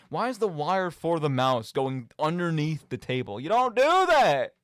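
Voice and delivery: ordinary talk, nothing marked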